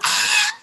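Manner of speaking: high joyful shriek; excited shriek. Retold or imitated. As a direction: let out a thrilled scream. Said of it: "I'm like".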